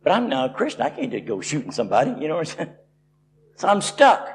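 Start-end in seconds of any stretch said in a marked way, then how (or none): none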